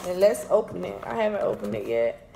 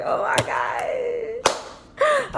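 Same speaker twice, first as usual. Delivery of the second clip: much higher, slow, drawn-out gush; over-the-top thrilled squeal; gushing oh my God; 14 semitones higher, much slower than usual. Real, not acted. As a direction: stretch it out like you can't contain your love of Starbucks. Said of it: "I know I'm being extra".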